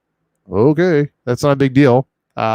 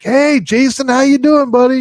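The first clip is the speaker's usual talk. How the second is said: high, loud excited buddy greeting; imitating Luis Cuevas; 12 semitones higher, 7 dB louder than usual. Host imitating a guest's greeting, loud and high. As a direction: greet him like an old pal, way up high. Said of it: "Luis Cuevas when he gets on and he's like".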